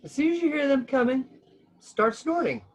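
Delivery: high, lightly voiced pig; a touch of a friendly pig. A narrator's reading. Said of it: "said Mister Pig".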